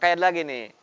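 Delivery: ordinary talk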